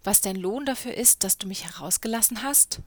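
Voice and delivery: plain reading voice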